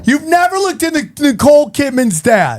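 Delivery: high, disbelieving question; can't believe he doesn't know; incredulous question. Real, not acted. Shocked at friend's ignorance.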